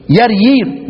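يريير repeated with y for each j; jim as ya. Shown as a demonstration. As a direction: pronounce jarjir as yar-yir, يريير. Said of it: "الجيم يا".

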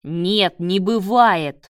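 loud, slowed, furious retort; exploding. A narrator's reading. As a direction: blow up and hammer each word. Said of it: "взорвался Давид". (взрываясь от гнева, громко)

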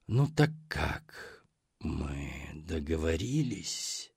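low, soft, slow monster voice; gurgling sea hag. Acted.

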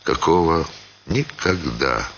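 ordinary delivery

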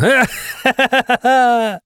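high, acted cackle; boastful laugh. A narrator's reading. Driver boasts about the bull bar, cackling.

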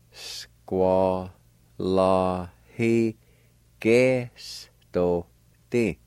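Squalahigestote stretched out very slowly, syllable by syllable. Shown as a demonstration. Teacher demonstrating Squalahigestote for learners, very slow and broken up. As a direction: draw out every syllable of Squalahigestote with gaps between. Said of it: "pronounce them out kinda slow".

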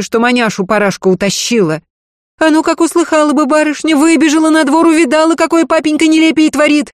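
high scolding housekeeper. A narrator's reading. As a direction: give him a proper dressing-down. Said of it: "Дунька устроила своему барину разнос". (отчитывая)

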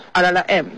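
"L L M" blurred quickly into el-la-la-em; fast linked speech. Retold or imitated. Student quoting her teacher to ask why, fast linked "L L M". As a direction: rattle off "L L M" as one linked word. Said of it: "会发得这么快，变成".

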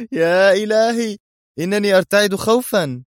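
very high, hammed-up mock terror; mocking fake fear. A narrator's reading. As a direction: pretend to tremble at the threat, sarcastically. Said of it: "ضحكة ساخرة عالية. قال بعدها". (خوف مصطنع ساخر بنبرة عالية)

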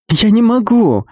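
high, panicked cry; panicky. Acted. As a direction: blurt it out in rising panic. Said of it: "с нотками паники в голосе ответила".

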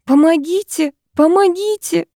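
high cries for help, rising on the second; calling for help. A narrator's reading. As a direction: call out to the old man for help. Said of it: "она громко закричала".

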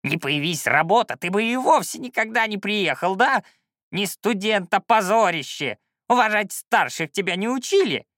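put-on high, creaky, indignant old man; scolding old shaman. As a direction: tell off a wayward pupil. Old shaman keeps scolding his pupil.

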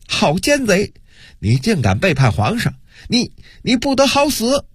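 high-pitched outraged denunciation, little added volume; angry roar. A narrator's reading. Official cursing a traitor from the ranks, angry roar. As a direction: denounce the traitor in rising, high-pitched fury. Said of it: "忽然传来了一阵怒吼".

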